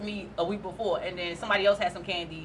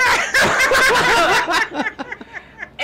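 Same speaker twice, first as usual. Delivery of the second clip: loud, high burst of laughter; 12 semitones higher, 8 dB louder than usual. Real, not acted. Comic cracks up retelling the edible set. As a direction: break up laughing.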